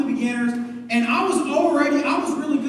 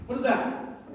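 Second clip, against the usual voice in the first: normal talking voice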